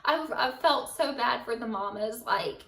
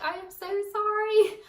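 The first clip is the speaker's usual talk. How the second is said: apologetic; 10 semitones higher, 5 dB louder than usual. Retold or imitated. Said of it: "And I was like".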